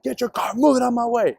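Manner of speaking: high mock command to move the car; retold tough guy barking an order; bossy order. Angry man demanding move.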